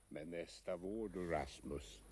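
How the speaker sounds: quiet promise to Rasmus, low and soft; tender hush. Acted. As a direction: murmur it gently to the boy beside you. Tramp speaks softly to Rasmus.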